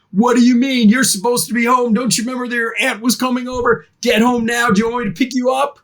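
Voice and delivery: high, fast, mock-scolding mother; fake angry mom. Mom texting back a fake scolding to rescue her kid, high and fast. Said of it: "my mom knows to text back".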